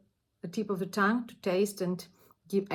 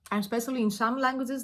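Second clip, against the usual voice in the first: ordinary delivery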